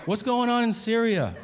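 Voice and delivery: high mock earnest question. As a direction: switch to innocent concern. Sudden earnest news question.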